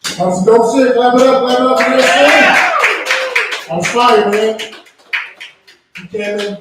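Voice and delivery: ordinary talk, nothing marked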